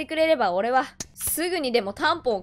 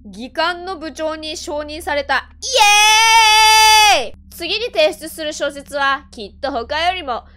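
theatrical reading; 4 semitones higher, 7 dB louder, much slower than usual. Pretend. Reading game text theatrically.

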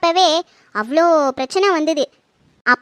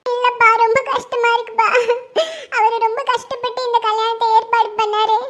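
high-pitched, sobbing voice; crying between phrases, pitched high; tearful distress; 7 semitones higher than usual. Acted. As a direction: explain the hurt through tears. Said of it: "நீயே இப்படி கண் கலங்குனீன்னா".

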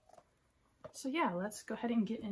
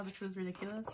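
normal talking voice

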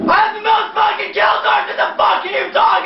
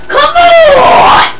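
screamed high and loud in rage; furious scream; 9 semitones higher, 11 dB louder than usual. Real, not acted. Gamer screaming at his screen in raw rage. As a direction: let it rip, high-pitched and furious. Raging gamer losing a match.

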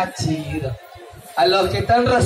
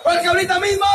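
silly clown; 8 semitones higher, faster than usual. Acted. Clown leads crowd in shouted chant.

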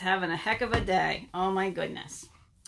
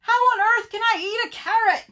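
high, loud, exasperated question; shouting; 14 semitones higher, 9 dB louder than usual. Acted. Bird protests to Rabbit about the carrot, shouting. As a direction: squawk the protest at full voice. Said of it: "shouted Bird".

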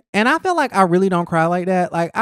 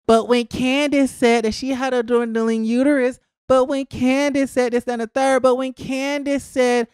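high-pitched mock complaining of fans; mocking imitation; 6 semitones higher than usual. Host imitating Gizelle's fans defending her, mocking. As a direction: parrot the objections in a raised, singsong run.

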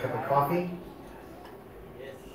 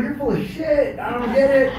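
loud, higher exasperated protest; retorting in disbelief; 8 semitones higher, 7 dB louder than usual. Retold or imitated. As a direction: call out the nonsense, exasperated. Arguing back at a promise.

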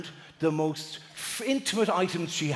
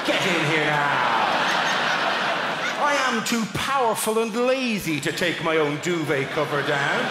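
slow, imperious lordly voice; hammed-up haughty master issuing commands; rich middle-class prick; slower than usual. Rich guest ordering the chambermaid about, pompous and slow. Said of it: "worst kind of rich, middle-class prick".

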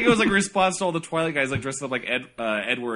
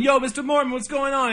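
high excited greeting; 11 semitones higher than usual. Retold or imitated.

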